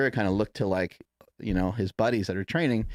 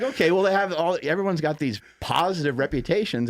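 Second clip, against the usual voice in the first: 1 laughing through words; 8 semitones higher, 4 dB louder than usual. Real, not acted. Quote said while laughing.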